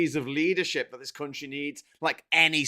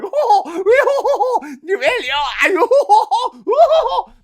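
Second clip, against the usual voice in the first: very high, loud, laughing posh warble; mock the plummy radio guests cooing; much higher, louder hammed-up posh cooing with laughter; posh people; 14 dB louder than usual. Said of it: "all these posh people going".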